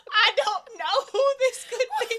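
high, squeaky, through laughter; laughing high. Real, not acted.

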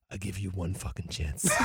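low, menacing dad voice; tough dad threat. Retold or imitated. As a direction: growl it like a crazy tough dad. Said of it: "he goes".

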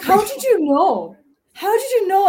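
high, loud mock amazement; exaggerated, put-on surprise; sarcastic. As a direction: play sarcastically astonished, pitched well up. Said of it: "could they tell you were being sarcastic".

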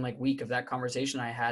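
normal talking voice

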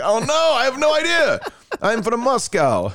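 high-pitched, put-on Russian voice; Russian accent. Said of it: "I'm talking in my Russian voice".

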